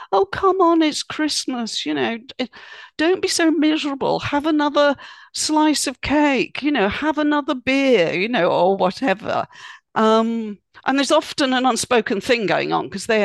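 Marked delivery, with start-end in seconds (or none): 0.1-8.1 high, pleading mock coaxing; coaxing relative. Relative coaxing you at Christmas, high and pleading. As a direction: wheedle them to have more. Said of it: "who say things like".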